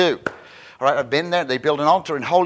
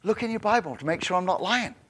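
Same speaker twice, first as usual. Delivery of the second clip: ordinary talk, nothing marked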